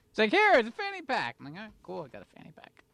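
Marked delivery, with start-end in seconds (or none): 0.3-1.4 high chirpy handing-over voice; high mock salesperson. Salesperson quote retold. 1.6-2.8 soft fast deadpan mutter; sarcastic mutter. Retold or imitated. Kid's sarcastic reply retold.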